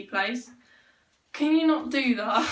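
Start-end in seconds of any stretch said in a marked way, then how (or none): 1.3-2.5 laughing. Real, not acted. Laughing, telling someone off.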